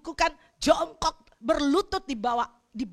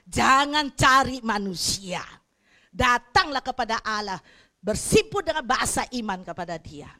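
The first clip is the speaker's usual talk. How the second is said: preaching at full force; 6 dB louder than usual. Real, not acted. Preacher exhorting loudly.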